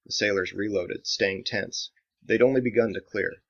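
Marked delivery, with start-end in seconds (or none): none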